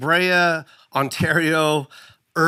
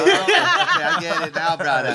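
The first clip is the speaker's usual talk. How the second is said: put-on Hawaiian pidgin accent. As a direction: stretch it out like a Hawaii local. Mock Hawaiian local voice.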